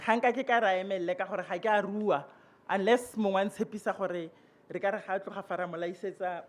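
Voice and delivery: usual speaking voice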